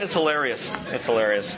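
ordinary delivery

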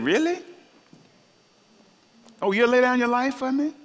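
doubting Peter's boast, mock surprise; incredulous Jesus. Jesus doubting Peter's promise, high and incredulous. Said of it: "Jesus said".

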